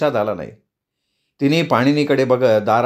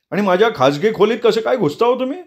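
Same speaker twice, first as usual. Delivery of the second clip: higher, indignant, accusing; angry demand; 9 semitones higher than usual. A narrator's reading. Marudgan angrily accuses the group of entering his room. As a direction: accuse them of barging in. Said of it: "मरुद्गण चिडून म्हणाला".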